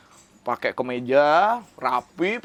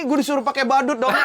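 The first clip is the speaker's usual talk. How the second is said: normal talking voice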